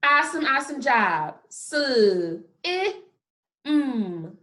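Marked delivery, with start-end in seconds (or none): 1.5-4.4 sl, i, mmm, each apart; sound by sound. Shown as a demonstration. Teacher answering what sounds you hear, one by one. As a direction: break slim into separate sounds. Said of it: "What sounds do you hear?".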